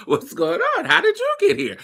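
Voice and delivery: high, prim voice of a baffled tourist. Retold or imitated. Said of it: "They be like".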